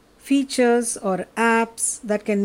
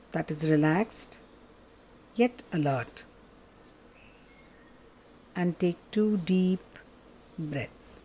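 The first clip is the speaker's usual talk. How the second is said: soft, lower, unhurried meditation voice; guided meditation; 4 semitones lower, 5 dB quieter than usual. Acted. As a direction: guide them gently into stillness.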